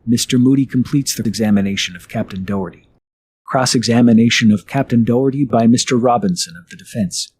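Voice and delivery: ordinary talk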